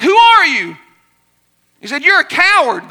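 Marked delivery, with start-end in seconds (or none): 0.0-0.8 high, loud echo; imitated echo shout; echoed call. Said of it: "the voice came back". 2.0-2.9 high, loud call; acted-out boy's shout; shouted taunt. Boy shouting at the voice.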